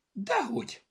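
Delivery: high, loud, emphatic cry; shouted denial. A narrator's reading. Zorka refuses to let Bogi give up, shouting. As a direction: cry out to contradict, pitch shooting up. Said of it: "kiáltotta Zorka".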